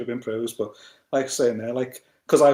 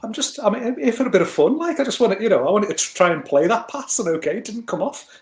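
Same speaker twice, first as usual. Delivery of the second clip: high, louder pleading in self-defence; retold protest; 5 semitones higher, 5 dB louder than usual. Casual player protesting to shouting teammates, exasperated. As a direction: plead your case to annoyed teammates, voice raised.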